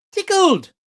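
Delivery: "tickled" squeaked high and loud; mocking stress. Streamer mocking the clip, "tickled" squeaked high.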